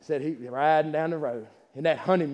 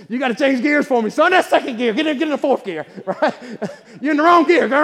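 laughing mock scold; 8 semitones higher, 8 dB louder than usual. Wife scolding in old truck.

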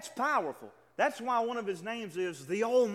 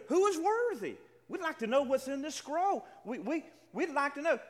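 pleading question; 8 semitones higher than usual. Acted. Preacher voices those seeking the scroll.

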